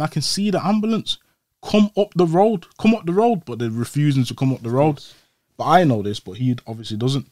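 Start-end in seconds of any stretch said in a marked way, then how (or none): 0.0-3.4 urgent calling. Retold or imitated. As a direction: urge the ambulance up the road. Old man urging ambulance on phone.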